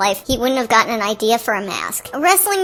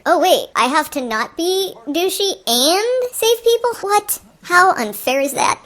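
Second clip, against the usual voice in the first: slow, put-on whiny hero voice; mock the hero's outrage; hammed-up hero complaining; 5 semitones higher, slower than usual. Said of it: "usually problems come later".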